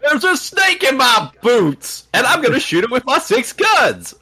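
cowboy voice. Retold or imitated. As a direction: ham up a cartoon cowboy. Cowboy Woody impression.

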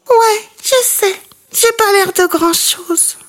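tiny high-pitched voice. Acted. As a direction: squeak it sadly like a little bat. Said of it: "de sa petite voix aiguë".

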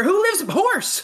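high, building to an exclaimed name; excited reveal. Acted. Narrator reveals who the cat saved, high excited. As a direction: announce the surprise rescue.

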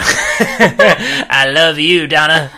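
slow, loud, laughing creepy croon; creepy Piers impression. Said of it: "you went really creepy then".

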